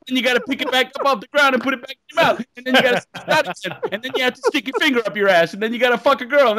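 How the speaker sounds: rapid exasperated list. Retold or imitated. Rattling off absurd instructions.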